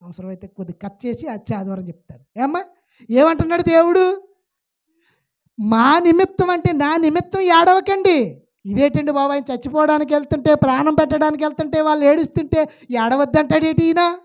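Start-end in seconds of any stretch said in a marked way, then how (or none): 3.1-14.2 loud, high preaching voice, pushing each phrase out; full-force preaching. Acted. Preacher booming at the congregation in full preaching force. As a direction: preach it hard to the pews.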